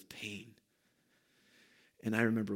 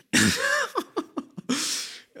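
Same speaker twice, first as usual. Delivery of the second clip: high giddy nervous laugh; 4 dB louder than usual. Real, not acted. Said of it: "I am shaking".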